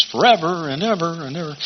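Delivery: "ever" repeated as a softening, rolling echo; echoing Messiah refrain. Retold or imitated. Preacher recalls Handel's Messiah, echoing "and ever". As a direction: roll "forever and ever and ever" along like a choral refrain. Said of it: "reverberations of Handel's Messiah".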